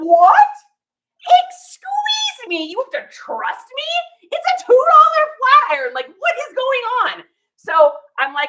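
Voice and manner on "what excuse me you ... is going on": much higher and louder, stunned disbelief; much higher, louder, affronted "what?"; mock outrage. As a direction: explode in offended shock. Shocked at seller's distrust.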